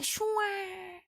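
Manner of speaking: high, soft, long "shwa" glide; smooth scooping sound. Shown as a demonstration. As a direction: imitate the scoop gliding through, softly fading. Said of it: "it goes like".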